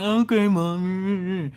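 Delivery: put-on slow, drawn-out sulky kid voice; lightly voiced kids humouring mom; kids' reply. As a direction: drag it out like a bored kid.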